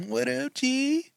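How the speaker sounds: high, soft, drawn-out hello; mock phone greeting. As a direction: stretch it out playfully, rising at the end.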